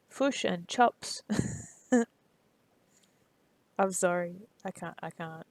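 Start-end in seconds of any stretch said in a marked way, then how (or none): 0.1-1.2 Kiwi accent, "chops" for chips, said slowly; slow Kiwi accent try. Shown as a demonstration. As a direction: draw out "Fish and chops" with a Kiwi "i". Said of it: "I'm not good at ac-, at the accent".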